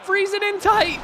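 loud, high gamer yell; excited shout. Real, not acted. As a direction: shout it at the play, high-pitched. Excited call during hockey play.

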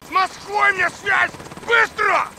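high-pitched shouted demand; urgent order. Acted. Commander demanding contact with Moscow, urgent shouted order. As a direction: call out for a connection, urgently.

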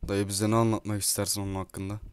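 ordinary talk

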